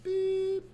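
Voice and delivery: high electronic beep sound; mock answering machine beep. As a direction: squeak out a voicemail beep.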